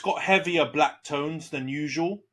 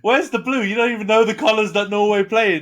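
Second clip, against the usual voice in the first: louder and higher, teasing scorn; mocking outburst; 7 semitones higher, 9 dB louder than usual. Real, not acted. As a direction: shout him down like a mate who got it wrong. Mocking friend over Norway colours.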